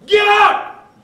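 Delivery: sharp high shouted command; parent's shout. Retold or imitated. Parent yelling at the child over spilled milk, shouted. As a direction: bark it like a fed-up parent.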